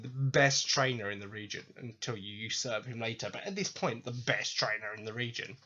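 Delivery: usual speaking voice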